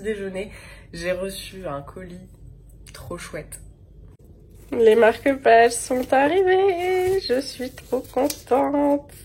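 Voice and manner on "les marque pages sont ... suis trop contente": thrilled, high and slow; excited. Real, not acted. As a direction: gush over the parcel. Colis reçu, joie. (très contente, voix aiguë et émerveillée)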